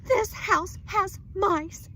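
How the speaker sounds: slow, high, loud crying complaint; upset wife wailing to husband; tearful wail. Acted. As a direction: wail it near tears.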